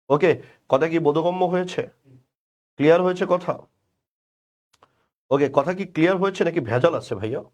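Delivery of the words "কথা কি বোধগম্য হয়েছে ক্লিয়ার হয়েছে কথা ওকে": normal talking voice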